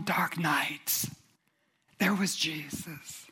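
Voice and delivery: whispered and slowing; hushed whisper. Real, not acted. Preacher telling of night turning to day, awed whisper. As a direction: drop to a breath of awe. Dramatic hushed climax of sermon.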